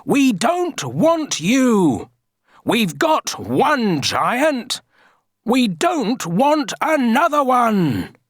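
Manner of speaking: high, slow, stretched-out crowd yell. A narrator's reading. Villagers rejecting a second giant, yelling. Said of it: "yelled the people".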